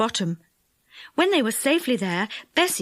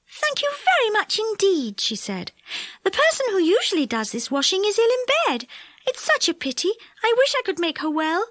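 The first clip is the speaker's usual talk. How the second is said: Silky; 10 semitones higher than usual. A narrator's reading.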